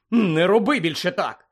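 startled scolding. A narrator's reading. Harry to the elf who startled him, rattled scolding. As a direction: scold after a fright.

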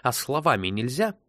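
mildly frustrated exclamation; exclaiming. A narrator's reading. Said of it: "воскликнул Сет".

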